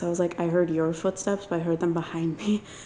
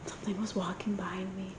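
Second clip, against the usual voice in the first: hushed, tense whisper in the dark; nervous night vlog whisper; 11 dB quieter than usual. Real, not acted. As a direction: whisper it so nothing hears you. Dark castle walk, ghost hunt.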